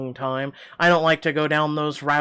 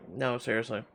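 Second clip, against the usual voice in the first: ordinary talk, nothing marked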